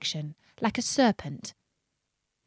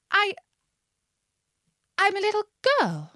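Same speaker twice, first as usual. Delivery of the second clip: high, hesitant little voice; falter, then offer it high and unsure; doubtful; 11 semitones higher than usual. A narrator's reading. Alice answering the pigeon after many changes, doubtful. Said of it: "rather doubtfully".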